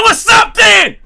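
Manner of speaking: furious shouted dare; angry challenge. Retold or imitated. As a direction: yell it right at him, daring him. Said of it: "I'm angry right now".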